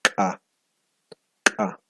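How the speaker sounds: "Ka" popped with a throat catch; sharp glottal "Ka"; glottalized ka. Shown as a demonstration. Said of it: "La glotalizada". ("Ka" glotalizada, explosiva)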